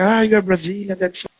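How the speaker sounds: high-pitched imitation of an excited admirer; delighted reaction. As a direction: gush like someone thrilled to meet you. Acts out admiring Australians' reaction.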